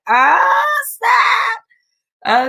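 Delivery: high, loud hyped-up Ah snap; excited shout. Retold or imitated. Host reacting to the intro music of her favorite show, loud excited shout. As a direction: yell it like the best part just hit. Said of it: "I'll be like".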